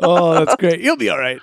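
ordinary talk, nothing marked

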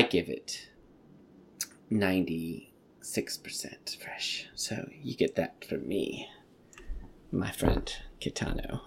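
soft, slow whisper of devotion. Acted. To director Kitano, a soft, slow whisper. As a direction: whisper it like a secret love note.

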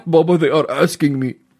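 put-on slow, drawn-out kid voice; imitating son. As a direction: mimic the boy, slowly and earnestly. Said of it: "ابني كان هذيك اليوم عم بيعمل هيك".

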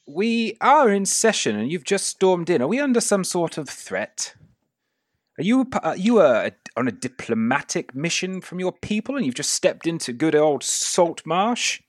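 put-on high-pitched, questioning older man; flustered older gentleman. Older man questions a stranger who stormed in, high and querying. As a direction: pitch it well up, querying the intruder.

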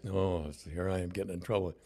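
ordinary delivery

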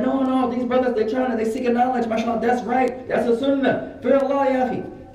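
high, insistent protest on their behalf; earnest defence. Retold or imitated. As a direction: imitate a guard stepping in to defend. Guard defends them in retold scene.

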